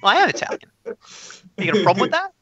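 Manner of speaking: put-on Italian accent, pitched high. Asked about being Italian, playing it up in an Italian accent. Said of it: "You just slipped into the accent though".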